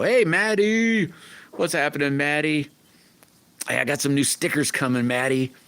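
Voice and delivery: high, bright call out to a viewer; light up and sing out the name; excited greeting. Real, not acted.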